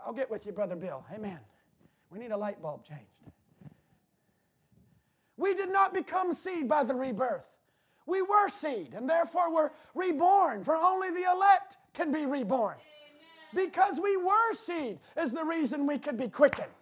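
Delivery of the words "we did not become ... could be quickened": preaching. Acted. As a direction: preach it out to the congregation. Preaching at full force.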